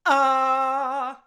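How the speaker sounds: glottal attack on Ah, loud and sudden; hard onset. Shown as a demonstration. Teacher demonstrating a hard onset on Ah. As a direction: burst the Ah open from a held breath. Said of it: "burst your vocal folds open on a very thick vocal fold like this".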